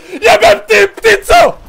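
furious cursing yell; angry shout. Real, not acted. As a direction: curse it out loud. Rage at bird.

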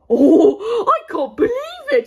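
high, fast squealing with laughter; giddy with disbelief; overjoyed squeal. Acted. Overjoyed new king celebrating.

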